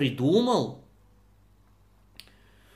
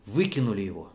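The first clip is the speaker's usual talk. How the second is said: usual speaking voice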